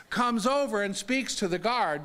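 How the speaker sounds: ordinary delivery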